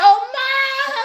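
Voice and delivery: high, gasping mock surprise. Picturing the viewer at the bank, high mock surprise. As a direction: squeak it like a stunned client. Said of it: "you gonna be like".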